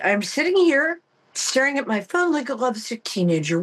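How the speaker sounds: ordinary talk, nothing marked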